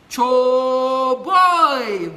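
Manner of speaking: loud, high cry of approval; big hype call; rousing shout. Real, not acted. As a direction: shout it out to fire them up. Rousing exclamation during pep talk.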